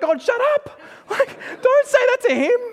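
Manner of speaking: squealed high and loud, cracking into a laughing cry; panicked plea. Pretend. Pretending to be Job, panicking as God points Satan his way. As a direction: shriek in mock panic at God, half laughing. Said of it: "I'd be like".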